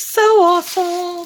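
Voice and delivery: real delight; excited gush. Real, not acted. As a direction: blurt it out thrilled. Delighted reaction to the gift.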